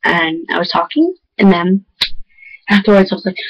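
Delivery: ordinary delivery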